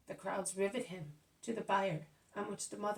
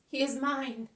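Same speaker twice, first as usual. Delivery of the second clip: higher, loud, possessive cry; shout; 5 semitones higher, 11 dB louder than usual. Acted. Grieving mother to Jesus, shouting in defence. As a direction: fling it out to push him away. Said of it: "she shouts".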